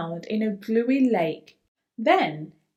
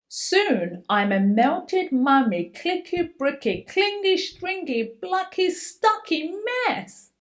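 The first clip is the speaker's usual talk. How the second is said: high, lighter chanted list; sing-song refrain; 6 semitones higher than usual. A narrator's reading. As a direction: chant the list playfully, slowing at the end. Refrain again.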